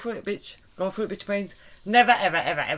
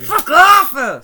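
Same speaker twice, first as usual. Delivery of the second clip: sudden furious yell, much louder; angry shout; 10 semitones higher, 14 dB louder than usual. Real, not acted. Friend teasing her, she yells furiously. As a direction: snap it out as a loud shout. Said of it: "[name] getting angry".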